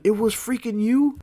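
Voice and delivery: high, drawn-out question of disbelief; incredulous. Retold or imitated. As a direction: blurt it out, voice shooting up. Finds out cousin pranked him.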